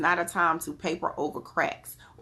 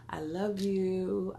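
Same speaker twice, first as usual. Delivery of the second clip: slow tender farewell; 2 semitones higher, much slower than usual. Real, not acted. Final drawn-out sign-off.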